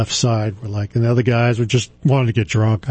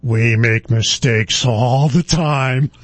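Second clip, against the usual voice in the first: put-on slow drunk; much slower than usual. Drunk doctor's slow confession.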